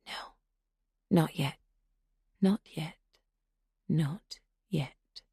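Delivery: fading sinister whisper. A narrator's reading. Intruder restraining themself.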